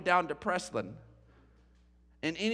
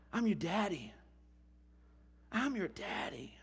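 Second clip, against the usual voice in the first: much softer, choked-up whisper to a newborn; tender, emotional; 9 dB quieter than usual. Real, not acted. Dad softly telling his newborn who he is, emotional. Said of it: "I'm gonna get emotional again".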